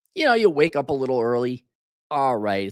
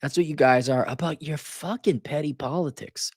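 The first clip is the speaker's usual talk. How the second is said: ordinary talk, nothing marked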